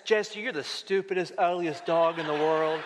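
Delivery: sweet, tender dog-owner talk; loving voice. Acted. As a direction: coo it lovingly to a pet dog. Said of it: "as long as I said in a loving voice".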